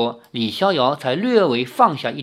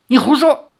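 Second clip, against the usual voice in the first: higher, loud angry snap; angry retort; 10 semitones higher, 7 dB louder than usual. A narrator's reading. Li Xiaoyao angrily rejects the maid's claim, loud. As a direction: snap at her in anger. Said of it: "李逍遥怒道".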